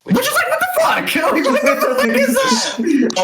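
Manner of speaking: high-pitched incredulous laughing; laughing disbelief. Real, not acted. Friend reacting to an absurd film story, laughing in disbelief. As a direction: laugh through total bafflement.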